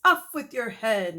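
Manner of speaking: loud, mock-royal cry; hammed-up king; grand threat. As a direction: declare it dramatically. Principal swings sword.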